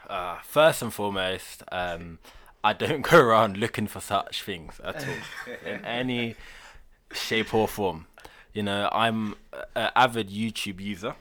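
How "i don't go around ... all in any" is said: nervous laugh. Real, not acted. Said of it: "my nervous laughter".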